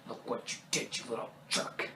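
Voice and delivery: harsh whispered telling-off; scolding whisper. Retold or imitated. Uncle Frank to Kevin, scolding whisper.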